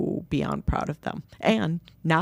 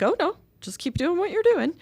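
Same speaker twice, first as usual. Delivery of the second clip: high-pitched, hushing reassurance; imitating encouraging nurses; nurses' gentle encouragement. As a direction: wave her on in a much higher voice. Nurses encouraging the mom, retold.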